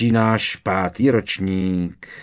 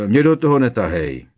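louder, hurried protest; hasty blurt; 3 semitones higher, 5 dB louder than usual. A narrator's reading. Ron refusing to be dragged in, hastily blurting. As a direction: blurt it out in mild alarm. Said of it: "vyhrkl honem Ron".

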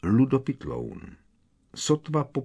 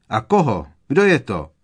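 higher and loud, pressing hard; urgent shout; 4 semitones higher, 8 dB louder than usual. A narrator's reading. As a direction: demand an answer at once. Skrk keeps shouting.

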